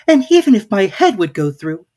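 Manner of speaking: Alice frustrated at the tiny door. A narrator's reading. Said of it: "thought Alice".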